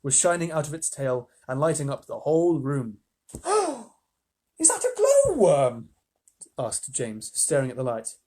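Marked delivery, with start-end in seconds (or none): 3.3-3.8 sharp high gasp of surprise; surprised gasp. Acted. Reader voices surprised gasp. 4.6-5.9 high, slow, wondering question; amazed question. Acted. James amazed at the light.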